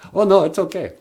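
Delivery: ordinary delivery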